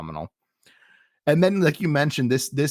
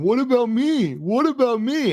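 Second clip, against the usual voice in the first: whiny; 11 semitones higher than usual. Retold or imitated. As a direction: whine it like a self-pitying babyface. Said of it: "he's a babyface who's whining".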